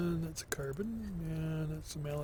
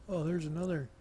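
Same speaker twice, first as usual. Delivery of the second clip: usual speaking voice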